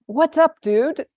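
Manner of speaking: high, loud, forced friendly hello; nervous casual greeting. Retold or imitated. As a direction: fake calm while terrified. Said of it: "He says".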